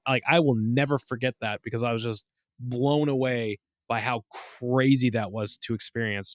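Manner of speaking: ordinary talk, nothing marked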